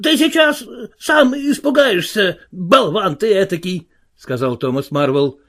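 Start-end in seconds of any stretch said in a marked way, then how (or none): 0.0-3.9 high-pitched indignant character voice; lightly acted threat at an unseen joker; angry threat. Марвел грозит невидимому голосу. (возмущённая угроза высоким голосом)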